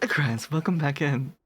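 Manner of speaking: ordinary delivery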